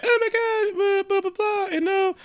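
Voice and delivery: very high, slow, put-on girly gush; a squealing airhead babbling; mock gushing diver. Mocking the divers at the bottom, very high girly gush. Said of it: "and like".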